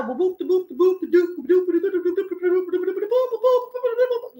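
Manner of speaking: high-pitched rapid "boop" babble; mock ranting gibberish. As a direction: imitate her tirade as high nonsense syllables. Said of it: "she gets mad at me and she starts".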